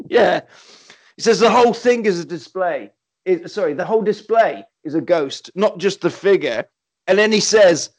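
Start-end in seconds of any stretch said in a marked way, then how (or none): none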